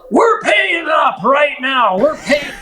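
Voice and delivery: high, slow mock demand; insistent old client. Client demanding to pay mid-waves, high slow insistence. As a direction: insist on paying right now. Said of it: "And he goes".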